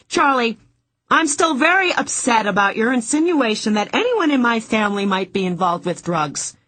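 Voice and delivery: high, loud, complaining woman; whiny voice. Acted. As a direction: whine and complain. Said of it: "Dorothy's whiny voice continued".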